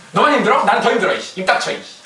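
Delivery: hammed-up irritated outburst; mock angry retort. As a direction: brush off a complaining worker. Acting a boss brushing off complaints.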